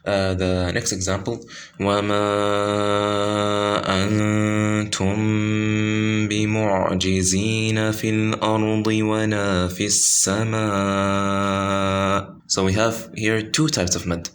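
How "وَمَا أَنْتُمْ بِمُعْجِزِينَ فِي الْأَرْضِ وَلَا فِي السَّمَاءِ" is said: chanted, drawn tajweed recitation; Quran recitation. Shown as a demonstration. Teacher reciting the example verse, chanted. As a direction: recite it with held madd vowels.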